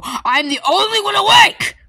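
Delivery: high, slow yell; slow despairing shout. Acted. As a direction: wail it out, dragged.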